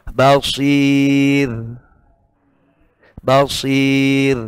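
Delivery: Basir ending on a thin, held r; thin r at pause. Shown as a demonstration. Teacher showing the paused Basir with a thin r. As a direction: stop on Basir and hold a light r. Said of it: "Jadi ditahan".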